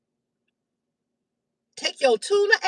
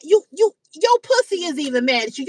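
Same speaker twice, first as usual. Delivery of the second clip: normal talking voice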